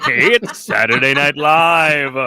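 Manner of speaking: loud, drawn-out live TV opening; mock show announcer. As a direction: belt it out slowly like a show opener. Parodies famous TV show opening.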